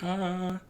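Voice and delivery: high, held cheerful "ah"; upbeat "ah". Retold or imitated. Reviewer imitating the upbeat soldier Gren, high cheerful "ah". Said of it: "he's, like, so upbeat".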